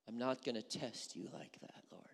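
low, soft, falsely humble voice; mock pious king. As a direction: play the king as a fake saint. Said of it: "He tells God".

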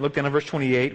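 usual speaking voice

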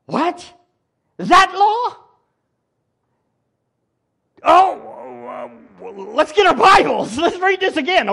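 high, loud startled scramble; hammed-up shock; flustered priests. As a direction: act out panicked priests reaching for Bibles.

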